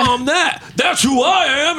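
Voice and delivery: hammed-up patriot shout; mock tough guy. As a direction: puff up like a flag-waving tough guy.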